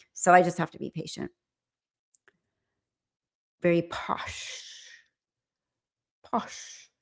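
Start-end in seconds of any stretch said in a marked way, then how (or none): 3.6-6.9 slow, soft upper-class English on "Very posh"; posh English accent. Shown as a demonstration. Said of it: "my posh accent".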